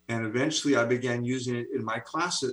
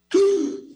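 sharp, very high, loud hammed-up gasp; mock shocked gasp; 9 dB louder than usual. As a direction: gasp as if caught off guard by the news. New math professor shock.

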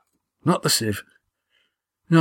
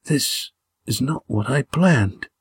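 slow, higher, strained dying voice; weak whisper; 5 semitones higher, slower than usual. A narrator's reading. Wounded Gar huddled with his two friends, weakly whispered. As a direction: confide it slowly with failing breath. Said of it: "he whispered".

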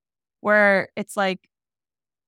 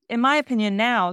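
normal talking voice

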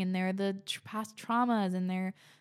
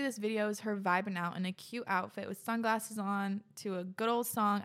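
ordinary talk

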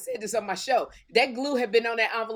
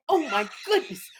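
put-on talking voice, higher and deliberate; Kim; 4 semitones higher, 4 dB louder than usual. Friend showing how Kim talks among rich people, mocking impression. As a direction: mimic your friend's affected voice, pitched up. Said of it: "Kim will start talking like this".

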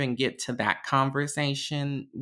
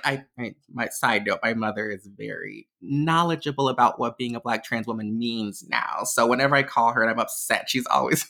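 ordinary talk

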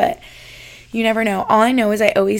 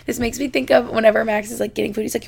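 normal talking voice

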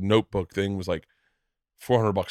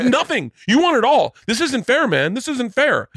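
high, louder mock whine of an angry dad; whiny complaint; 12 semitones higher, 4 dB louder than usual. Angry dad complaining to the winner, high whiny protest. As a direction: lay on the sulky protest. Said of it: "And he goes".

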